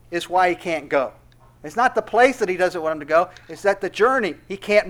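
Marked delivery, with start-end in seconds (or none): none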